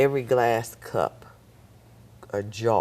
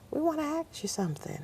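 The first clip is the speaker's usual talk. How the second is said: quick instructor voice; 5 dB quieter, faster than usual. Retold or imitated.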